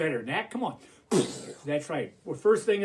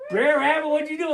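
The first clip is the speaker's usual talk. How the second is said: high, loud audience-member question; curious listener; 7 semitones higher, 3 dB louder, faster than usual. Acted. Somebody in the audience asking Brer Rabbit, high and curious. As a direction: pitch it up as an eager questioner. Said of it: "somebody here is gonna say".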